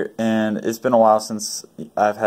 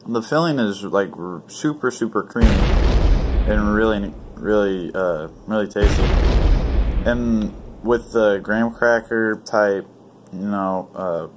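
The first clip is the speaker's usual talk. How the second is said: food in mouth; eating a pastry; chewing while talking. Real, not acted. Seen 0: Holds bitten pastry, mouth working between bites while speaking.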